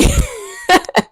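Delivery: giddy high laugh at her own joke; high squealing laugh. Real, not acted.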